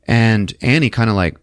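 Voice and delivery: ordinary talk, nothing marked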